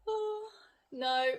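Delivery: plain reading voice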